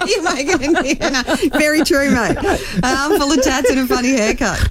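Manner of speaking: high, laughing as you talk; amused laughter. Real, not acted. Radio host echoing a caller's haircut theory, laughing. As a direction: agree while cracking up.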